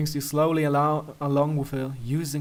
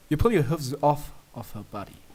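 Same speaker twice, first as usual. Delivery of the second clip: ordinary delivery, nothing marked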